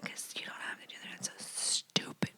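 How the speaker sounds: unvoiced, fast secret whisper; conspiratorial whisper. Real, not acted. Host whispering a secret question to the listener. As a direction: lean in and whisper it. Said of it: "secretive aside to listener".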